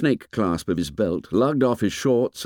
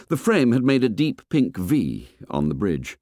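usual speaking voice

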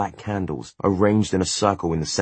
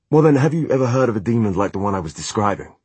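fast, higher, louder pleading; desperate; 5 semitones higher, 4 dB louder, faster than usual. Acted. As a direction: rush it out desperately. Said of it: "a tinge of desperation in his voice".

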